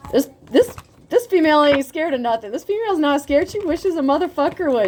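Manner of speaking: hyping up a fearless woman; climbing excitement; rising hype. Real, not acted. Building hype about fearless woman.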